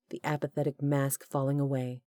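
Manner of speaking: plain reading voice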